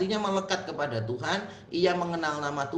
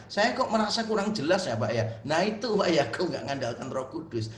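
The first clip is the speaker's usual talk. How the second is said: ordinary delivery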